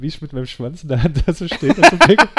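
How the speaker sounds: loud, laughing through the words; cracking up while telling it. Real, not acted. Embarrassing toilet story, cracking up.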